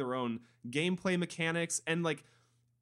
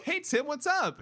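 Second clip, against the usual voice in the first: cheery greeting; 11 semitones higher, 4 dB louder than usual. Retold or imitated. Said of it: "and I'm like".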